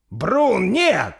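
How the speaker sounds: very high, loud cry of alarm; desperate shouted warning; Торстейн shouting. Acted. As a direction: yell to stop a comrade striking. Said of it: "прокричал Торстейн".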